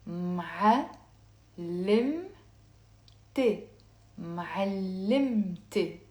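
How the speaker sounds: syllable by syllable, with gaps, then joined; slow. Shown as a demonstration. Teacher saying it for learners to copy, very slowly. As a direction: break معلمت into slow, separate syllables. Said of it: "I'll say it slow".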